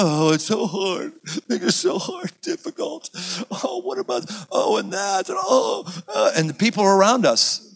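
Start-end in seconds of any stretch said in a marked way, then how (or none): 0.0-5.8 preacher mocking culture's self-pity, whiny and high; mock whining. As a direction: ham up being overwhelmed. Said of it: "we all".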